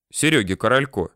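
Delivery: plain reading voice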